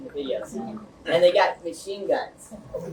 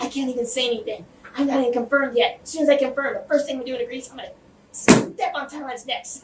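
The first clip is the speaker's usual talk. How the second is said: higher-pitched retelling of a tearful woman; imitated tearful woman; 7 semitones higher than usual. Retelling the crying ambassador's promise, imitated tearful voice. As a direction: imitate her upset voice, pitched up. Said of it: "she was crying".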